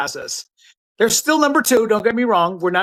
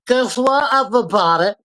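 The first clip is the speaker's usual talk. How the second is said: mock Trump; 3 semitones higher than usual. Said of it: "he was gonna be like".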